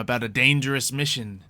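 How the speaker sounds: normal talking voice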